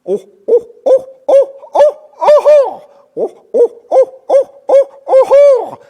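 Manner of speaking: very high loud owl hooting; high loud owl chorus calls; owl cackling. Retold or imitated. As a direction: cackle like a flock of barred owls carrying on. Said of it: "they say".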